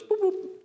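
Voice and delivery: high chirpy mock beeps; beep imitation. Trainer mimics the alarm being silenced, high mock beeps. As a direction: squeak out the detector's chirp.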